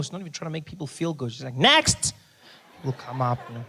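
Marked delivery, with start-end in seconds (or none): 1.6-2.1 high, loud, curt summons; unfriendly receptionist. Retold or imitated. Receptionist calls the next patient, unfriendly shout. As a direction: call out sharply, rising at the end. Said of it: "She's not friendly at all".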